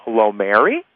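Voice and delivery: "Mary" rising sharply into a question; questioning greeting. Shown as a demonstration. Anybody phoning Mary, questioning greeting. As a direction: lift "Mary" as if asking. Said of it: "or Mary and go".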